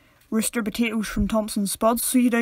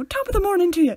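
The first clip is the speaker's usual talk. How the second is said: mocking, lilting tourist impression; mock Irish greeting; 8 semitones higher, 5 dB louder, faster than usual. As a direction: sing out a hammed-up stage-Irish hello. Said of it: "people always go".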